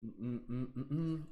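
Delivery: ordinary talk, nothing marked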